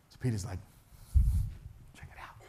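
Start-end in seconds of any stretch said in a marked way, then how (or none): 2.0-2.3 hushed aside; stage whisper as Peter. Acted. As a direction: whisper it excitedly.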